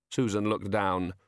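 plain reading voice

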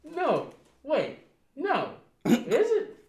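high, startled second-guessing; sudden unsure realization; surprised doubt. Real, not acted. Doubt about the lyric.

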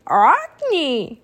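raven croak from the back of the throat; terrible croak. A narrator's reading. Jack trying to call Annie as a raven, terrible croak. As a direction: squawk a name as a bird would. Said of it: "a terrible croak came from the back of his throat".

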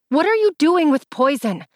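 high, loud accusing question; angry. A narrator's reading. Confronting stepbrother.

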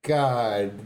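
usual speaking voice

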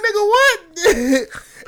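high, very slow, laughing; laughing disbelief. Retold or imitated. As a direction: stretch it through a laugh. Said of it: "I'm like".

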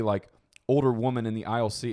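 ordinary talk, nothing marked

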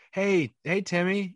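put-on high, sing-song hello to a child; overly cheery greeting to kid. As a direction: greet the kid far too brightly. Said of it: "the most uncomfortable thing".

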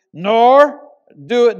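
loud, slow, solemn reading of the psalm; scripture reading. Acted. Preacher to his congregation, reading scripture loud and slow. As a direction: preach the verse out to the hall.

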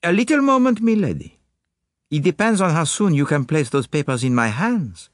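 put-on high, insistent accented detective; Poirot. Detective checking the lady's eagerness, high insistent voice. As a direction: cut in high and firm, with accent. Poirot's dialogue in narrated story.

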